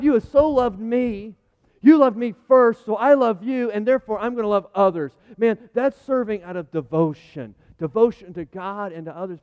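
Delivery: loud, high, impassioned preaching; full-voiced fervent preaching; preaching at full force. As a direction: drive it home to the congregation. Preaching climax.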